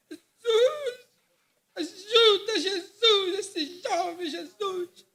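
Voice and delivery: high, loud, weeping plea; cry of the soul. Retold or imitated. Brother Viana embracing a youth, wailing a loud, high plea. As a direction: cry out to Jesus for the young man. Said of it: "Ele gritava assim, aquele grito da alma".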